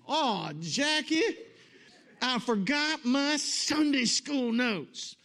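high, loud, drawn-out mock wail; imitated cry of dismay. Husband in the car wailing to his wife, imitated cry. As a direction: holler it slowly in hammed-up panic. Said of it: "Gary cried out again".

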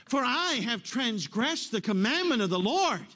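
loud, high, slow confession; crying out. Acted. King Saul continuing his confession to Samuel, crying out. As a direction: proclaim it as a drawn-out cry. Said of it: "Saul cries out to Samuel".

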